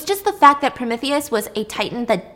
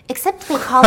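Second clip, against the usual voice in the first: cracking up mid-quote; breaking into laughter; 3 semitones higher, 9 dB louder, faster than usual. Real, not acted. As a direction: lose the character to a laugh, louder. Breaks up while voicing Helios.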